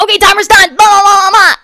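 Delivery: high, loud mocking singsong; mocking therapists. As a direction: mimic them as silly chatter. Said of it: "And then they're like".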